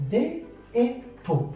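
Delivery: "Dé et pot" slowly, each syllable set apart. Shown as a demonstration. Teacher dividing dépôt for learners, "Dé et pot" syllable by syllable. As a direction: count out "Dé et pot" piece by piece. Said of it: "nous avons trois syllabes".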